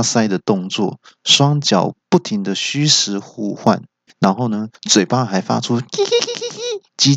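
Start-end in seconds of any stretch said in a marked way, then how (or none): 5.8-7.1 极高的猴子尖叫声; 学猴子叽叽乱叫; 猴子叽叽叫. A narrator's reading. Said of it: "叽叽叫的猴叫声". (模仿猴子的高声叽叽叫)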